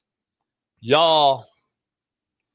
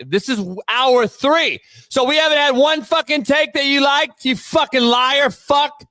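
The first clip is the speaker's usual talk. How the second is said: heated rant; 11 semitones higher than usual. Real, not acted. Ranting at viewers.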